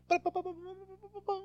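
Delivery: high rapid stuttering "ba" noises; imitated effect noise. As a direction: mimic a TV zoom effect high and light. Imitates TV show zoom effect.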